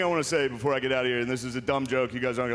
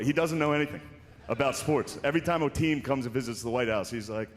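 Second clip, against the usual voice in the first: ordinary talk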